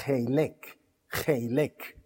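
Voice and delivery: Heilek with a throaty guttural H; guttural pronunciation. Shown as a demonstration. Preacher teaches the congregation a guttural Heilek. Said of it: "así, gutural".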